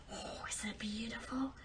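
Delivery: hushed, greedy whisper over the gold; whispered aside. Acted. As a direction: breathe it like a secret. Daughter admires gold aside.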